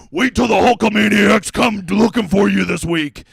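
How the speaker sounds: slow, lightly voiced wrestler trash talk; wrestling promo voice. Hulk Hogan style trash talk.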